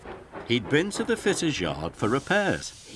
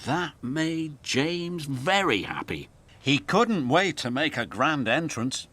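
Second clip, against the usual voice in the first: ordinary delivery, nothing marked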